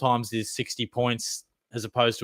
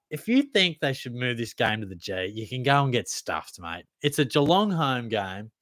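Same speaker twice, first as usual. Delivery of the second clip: ordinary talk, nothing marked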